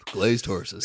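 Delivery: low-pitched advert sign-off; mock ad tagline. Completes a mock financial planning advert.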